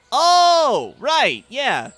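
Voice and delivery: high, loud drawn-out "oh"; sudden realization. Acted. Rouge reacting to the creature in the open, realizing. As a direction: react aloud as it dawns on you. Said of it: "Rouge, after seeing the creature ... goes".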